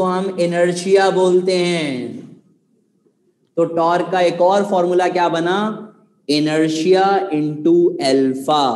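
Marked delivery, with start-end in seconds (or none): 6.3-8.6 very slow, word by word, lower; deliberate dictating pace; slow dictation. Shown as a demonstration. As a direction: spell out the formula slowly for note-taking. Teacher dictating formula answer.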